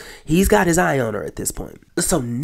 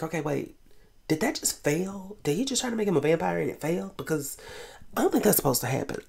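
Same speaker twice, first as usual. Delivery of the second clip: puzzled; 2 semitones higher, 7 dB quieter, faster than usual. Retold or imitated. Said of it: "I was like".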